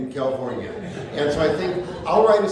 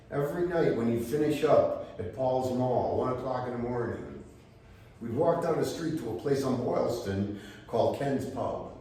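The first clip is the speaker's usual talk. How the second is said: ordinary delivery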